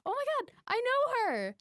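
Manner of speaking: startled happy realization; surprised recognition. Retold or imitated. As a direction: squeal it like spotting an old friend. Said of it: "I'm like".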